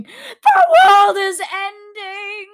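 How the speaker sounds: long drawn-out, high-pitched scream; very loud theatrical wail of doom. A narrator's reading. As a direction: scream it hysterically, stretching every word.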